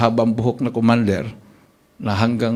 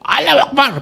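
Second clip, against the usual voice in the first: battle cry. Retold or imitated. The attacker grabbing the pastor's legs, shouting a high war cry. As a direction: yell it as you lunge.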